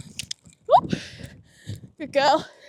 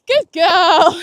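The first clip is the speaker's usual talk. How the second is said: loud, high delighted praise; excited praise; 4 semitones higher, 10 dB louder than usual. Real, not acted. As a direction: cheer the horse on. Praising horse after jump.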